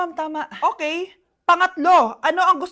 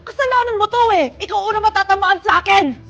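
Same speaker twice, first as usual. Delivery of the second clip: raised-pitch comic outburst; angry blaming; 3 semitones higher than usual. Acted. Mother turning on the teacher, angry threatening. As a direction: lash out in mock fury.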